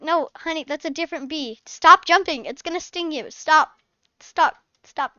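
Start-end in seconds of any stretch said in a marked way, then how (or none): 1.7-5.1 louder, sharp repeated commands; firm scolding. Acted. Mom ordering Emily to stop jumping, loud and firm. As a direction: tell her off to stop now.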